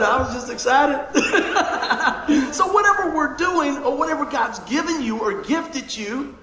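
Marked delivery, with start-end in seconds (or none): 0.0-1.0 loud, high, through laughter; amused mock excuse; laughing excuse. Laughing retelling of his excuse. 1.0-2.5 high, rolling chuckle; real giggling belly laugh; hearty laugh. Real, not acted. Laughing at his own story.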